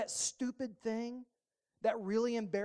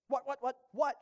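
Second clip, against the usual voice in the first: each What punched higher and louder; stress on what; 5 semitones higher, 5 dB louder than usual. Shown as a demonstration. Preacher drilling the word What at the congregation, emphatic. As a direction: hammer What, What, What, What. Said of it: "Emphasis on what?".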